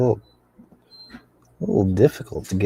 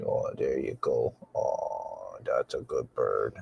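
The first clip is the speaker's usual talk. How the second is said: low, soft soothing coo; calm the bird down gently; 9 semitones lower, 10 dB quieter, slower than usual. Real, not acted. Soothing the parrot.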